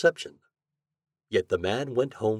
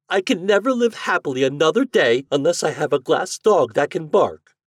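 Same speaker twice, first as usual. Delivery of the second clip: high, loud spoiled demand; demanding heiress; 8 semitones higher, 9 dB louder than usual. Acted. Heiress demanding the glass dog, high and loud. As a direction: insist like a spoiled child.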